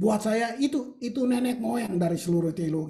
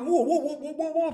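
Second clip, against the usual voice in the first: playful imitation of worshippers yelling; mock congregation shouts; 6 semitones higher than usual. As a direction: imitate a shouting crowd lightly. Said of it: "teriak-teriak gitu".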